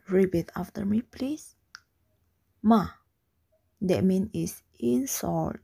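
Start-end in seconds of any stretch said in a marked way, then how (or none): 2.6-2.9 "Ma" short with a sharp drop; falling tone. Shown as a demonstration. Teacher has class repeat "Ma", falling tone. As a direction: punch "Ma" downward. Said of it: "The third tone is a falling tone".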